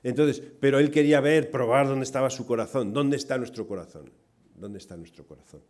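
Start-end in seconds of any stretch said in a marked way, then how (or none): 4.5-5.6 much softer, lower, reflective question; hushed repeat. Real, not acted. Preacher repeating the question to the congregation, hushed. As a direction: let the question sink in quietly.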